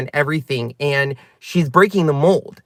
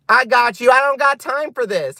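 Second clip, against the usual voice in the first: higher, sassy brush-off; sassy dismissal; 8 semitones higher than usual. Real, not acted. Brushing off commenter Robert Wagner, sassy and high. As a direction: shoo the troll away with attitude.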